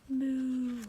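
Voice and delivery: a lightly voiced, voiced cow moo. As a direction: give a small playful moo. George makes animal noise.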